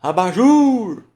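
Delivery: loud, high, sing-song holler; long singsong call with drawn-out JUR; calling someone out. Shown as a demonstration. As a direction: call it from the gate, stretching the last syllable. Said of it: "você tá chamando o abajur".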